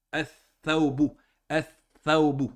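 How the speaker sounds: "Ath-Thawbu" with l silent and th doubled; marked shadda. Shown as a demonstration. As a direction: hold the th in "Ath-Thawbu", slow and deliberate. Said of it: "On va prononcer". (« Ath-Thawbu », th redoublé, sans lam)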